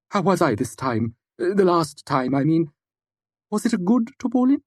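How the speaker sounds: eager odd scholar. Acted. Puppet asks Simon if his Taborlin was good, eagerly. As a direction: ask hopefully, a little put out.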